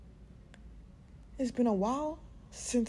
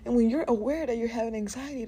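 ordinary talk, nothing marked

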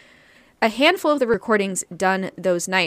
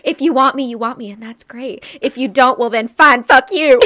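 mock crying rant; 4 semitones higher, 6 dB louder than usual. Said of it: "It's essentially what you're saying, like, crying".

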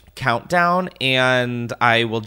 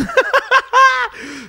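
high, loud burst of laughter; high cackling laugh; 5 dB louder than usual. Real, not acted. Host laughs at own remark.

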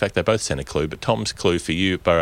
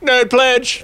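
rising, louder, grand announcement; announcer build-up; 14 semitones higher, 6 dB louder than usual. Acted. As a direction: build it up like a show host. Grand show-segment announcement.

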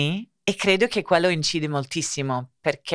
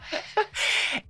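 breathy laugh; 6 dB quieter than usual. Real, not acted. Laughing at the joke.

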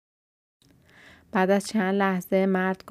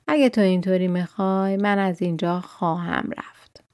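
ordinary delivery

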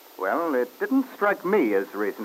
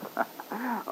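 soft breathy chuckle, quieter than speech; amused laugh; 9 semitones higher, 10 dB quieter than usual. Acted. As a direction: laugh off the accusation. Husband laughs at drunk accusation.

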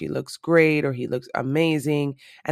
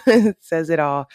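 laughing through the words, louder; 2 semitones higher, 5 dB louder than usual. Real, not acted. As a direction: laugh as you repeat it.

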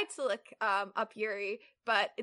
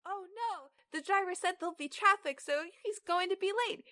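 much higher, put-on voice for a minor character; generic character voice; 8 semitones higher than usual. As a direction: pitch it way up for a generic side character. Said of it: "I'll just do a generic voice".

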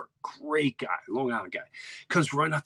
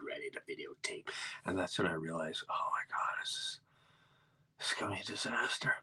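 much softer whispered panic; dread whisper; 4 semitones lower, 11 dB quieter than usual. Retold or imitated. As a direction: hush it like doom is coming. Realizing the disaster coming.

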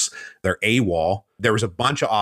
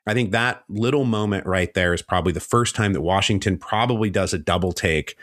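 ordinary delivery

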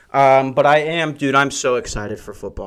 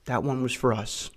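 conspiratorial aside; 11 dB quieter than usual. Retold or imitated. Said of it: "I go".

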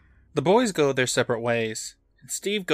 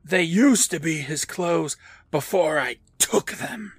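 creepy mask voice; 4 semitones higher than usual. Retold or imitated. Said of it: "But the mask says".

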